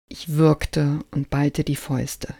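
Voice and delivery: usual speaking voice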